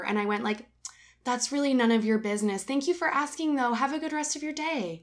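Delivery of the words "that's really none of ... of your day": higher, sweet sing-song teacher voice; lightly put-on polite teacher brush-off; kindergarten teacher voice. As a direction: smile through it like calming a child. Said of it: "My, like, kindergarten teacher took over".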